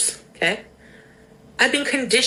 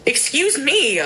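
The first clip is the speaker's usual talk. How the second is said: usual speaking voice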